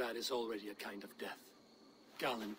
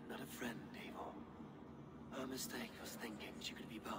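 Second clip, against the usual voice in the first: hushed whisper; 11 dB quieter than usual. Acted. Fading voice in a vision.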